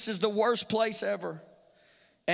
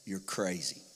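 much lower, soft scoffing; dismissive scoffing; 10 semitones lower, 8 dB quieter than usual. Pretend. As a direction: brush him off. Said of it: "They said".

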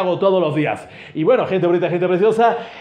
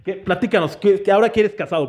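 ordinary talk

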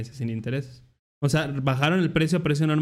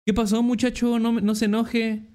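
higher-pitched placating vendor; retold plea, pitched up; imitated street seller; 7 semitones higher than usual. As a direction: imitate a seller calming an angry customer. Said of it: "¿Cómo me dijo?".

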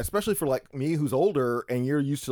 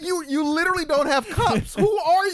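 pitched up, mock outraged; incredulous; 11 semitones higher than usual. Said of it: "And I was like".

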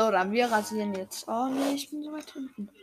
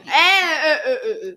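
laughing whimper; 3 semitones higher, 9 dB louder than usual. Real, not acted. Rammed in race, laughing cry.

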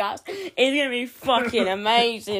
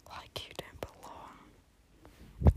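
whispered; 14 dB quieter, much slower than usual. Pretend. Whispered song line.

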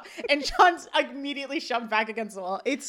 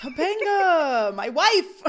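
drawn-out, higher, louder wail through laughter; mock-dramatic; 5 semitones higher, 8 dB louder, much slower than usual. Co-host hams up the boyfriend naming his wife, mock-dramatic. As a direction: declaim it like a lovesick husband, laughing.

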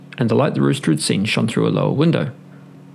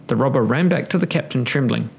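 ordinary delivery

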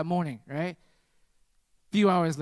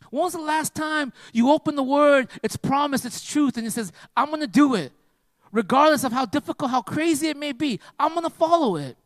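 raised, ringing sermon voice, pitched high; preaching at full force; 6 semitones higher, 4 dB louder than usual. Acted. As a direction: preach it out to the whole church. Preaches to congregation.

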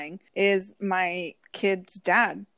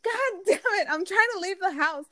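higher, put-on frazzled voice; mock stressed outburst, higher pitch; exasperated self; 10 semitones higher than usual. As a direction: play your rushed, fed-up self. Retold exasperation rushing out.

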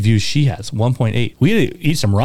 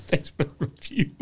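laughing read-out; 4 semitones higher, 11 dB quieter than usual. Real, not acted. Reading comment, laughing.

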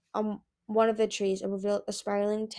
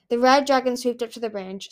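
ordinary delivery, nothing marked

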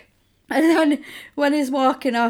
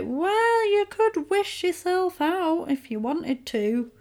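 a much higher, mock-sweet djinn tempting her; 6 semitones higher than usual. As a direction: dangle the offer like a sly genie.

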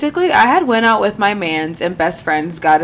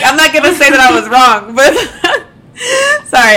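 laughing; 6 semitones higher, 5 dB louder than usual. Real, not acted. Admitting fault.

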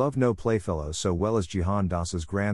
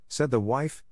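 ordinary delivery

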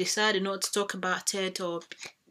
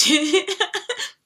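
loud, high burst of laughter; real high-pitched giggle fit; laughing; 11 semitones higher, 9 dB louder than usual. Real, not acted. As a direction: burst out laughing at your own joke. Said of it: "Sorry, it's not funny".

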